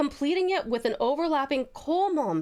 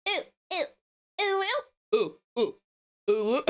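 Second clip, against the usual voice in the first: halting little oohs in someone else's voice; attempted voice imitation; 2 semitones higher, slower than usual. Streamer imitating a viewer before giving up laughing, attempted impression. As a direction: have a go at mimicking a friend's oohs. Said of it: "It's my attempt at your voice".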